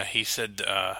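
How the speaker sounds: ordinary delivery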